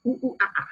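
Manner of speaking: playful monkey hoots; high "ooh ooh ah ah" monkey call; monkey sound. Shown as a demonstration. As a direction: hoot like a monkey for the kids. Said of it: "I want you to make a monkey sound".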